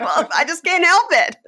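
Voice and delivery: high, loud retort through laughter; laughing protest. Retold or imitated. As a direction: plead helplessly while cracking up. Laughing defence in retold argument.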